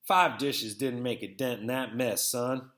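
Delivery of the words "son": usual speaking voice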